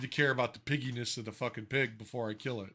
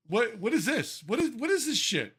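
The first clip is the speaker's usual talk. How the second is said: incredulous outburst; 8 semitones higher than usual. Real, not acted. Outraged at the article.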